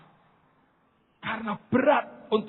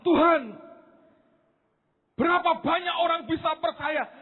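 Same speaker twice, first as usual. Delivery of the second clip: higher, startled cry to God; surprised cry; 8 semitones higher than usual. Retold or imitated. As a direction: call out to God in amazement. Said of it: "saya kaget". (seruan kaget kepada Tuhan)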